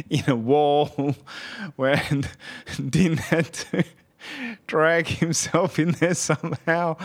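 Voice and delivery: talking through giggles, pitch lifting; real amusement breaking up the words; laughing. Real, not acted. Amused retelling of hilarious scene.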